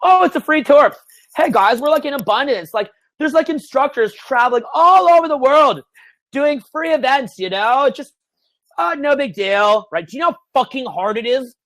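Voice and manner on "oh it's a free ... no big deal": high, mock-breezy patter; airy show of ease, pitched well up; effortless outside look. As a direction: act as if it all takes no effort. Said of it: "That's how it's meant to look".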